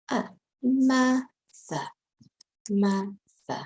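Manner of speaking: "mother" in two slow syllables, ending in uh; soft R ending. Shown as a demonstration. Teacher sounding out mother, slow soft ending. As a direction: split "mother" into a long first syllable and soft uh. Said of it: "very soft R sound".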